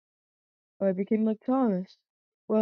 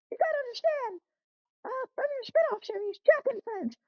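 much higher, nervous little engine voice; squeaky cousin engine; 12 semitones higher than usual. Acted. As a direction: pitch way up like a small anxious train. Jack keeps pleading.

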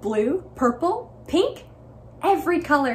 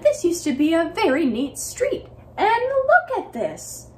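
higher, indignant complaint; furious neighbors; 6 semitones higher, 3 dB louder than usual. Acted. Angry neighbors complain about the street, indignant. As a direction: huff it out, scandalized. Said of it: "the neighbors were furious".

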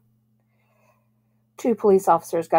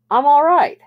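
loud, higher-pitched objection; indignant protest; 9 semitones higher, 8 dB louder than usual. A narrator's reading. Bobby objects to his mother checking on him, indignant. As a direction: insist loudly that you're fine.

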